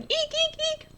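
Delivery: very high squealing "Eek"; shrill mock fright squeal; excited squeal. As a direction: squeal like you just saw a snake. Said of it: "I kept yelling".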